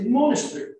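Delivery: MONASTERY in an Australian accent, as "monas-tree". Shown as a demonstration. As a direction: say "monastery" the Australian way, swallowing the E before R. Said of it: "also the way they would speak".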